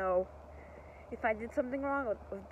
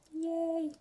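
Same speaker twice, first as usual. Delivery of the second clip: excited cheer; 9 semitones higher than usual. Real, not acted. Cheering after a win.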